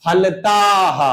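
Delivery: फलताः with a breathy हा at the end; teacher shows the visarga sound; visarga demo. Shown as a demonstration. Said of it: "हा निकल रहा है".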